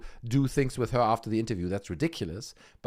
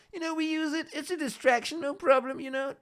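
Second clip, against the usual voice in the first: much higher, giggly voice; high mock giggle talk. Imitating the charmed ladies, much higher giggly voice.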